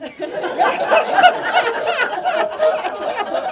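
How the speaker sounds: a high, hearty burst of laughter; loud laugh. Real, not acted. Teacher cracking up at her own punchline, high hearty laugh.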